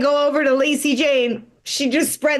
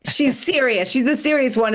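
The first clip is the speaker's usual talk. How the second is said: ordinary talk, nothing marked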